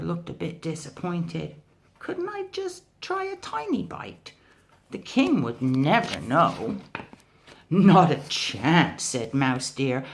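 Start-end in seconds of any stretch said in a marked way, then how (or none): 2.0-6.8 high pleading tiger voice; wheedling, high-pitched tiger. Acted. Tiger begging for a bite. 7.7-9.0 firm refusal. Acted. Mouse deer refusing firmly.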